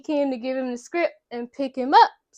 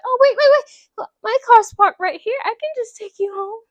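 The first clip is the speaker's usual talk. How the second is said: eager offer; 10 semitones higher, 5 dB louder than usual. Retold or imitated. Samantha eagerly offering a ride.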